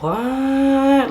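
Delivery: high, drawn-out incredulous what; mock disbelief. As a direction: stretch it upward in retold astonishment. Retold disbelief at being accepted.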